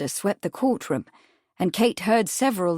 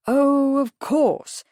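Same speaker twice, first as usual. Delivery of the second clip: mock-chagrined, drawn-out higher realization; 7 semitones higher, 4 dB louder, much slower than usual. A narrator's reading. Lawyer conceding the witness's point, chagrined. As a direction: concede it with put-on chagrin, pitch raised. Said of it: "replied in a chagrined tone".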